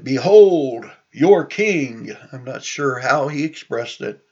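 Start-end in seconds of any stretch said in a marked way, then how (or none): 0.0-2.2 loud, drawn-out herald's announcement; loud proclamation. Retold or imitated. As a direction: proclaim it slowly and grandly to a crowd. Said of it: "he might have said it".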